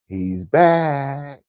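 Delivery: high, loud "back" stretched out; excited announcement. Real, not acted. Vlogger announcing he's back, excited and loud. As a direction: crow it high and drawn-out.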